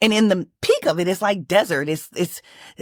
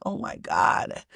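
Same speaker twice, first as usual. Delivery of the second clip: a retold breathless whisper of despair, softer; tearful whisper; 11 semitones lower, 7 dB quieter, slower than usual. Clinging to the log in her dream, a whispered tearful gasp. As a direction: gasp it out as if near tears. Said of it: "I'm crying".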